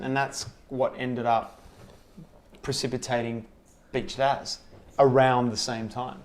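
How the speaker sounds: ordinary delivery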